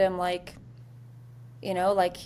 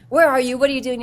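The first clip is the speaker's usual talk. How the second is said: louder, higher demanding questions; going off; 5 semitones higher, 8 dB louder, faster than usual. Retold or imitated. Sergeant demanding answers from her missing lead, angry. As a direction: snap the questions out, irritated. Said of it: "just going off".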